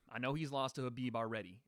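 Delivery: ordinary delivery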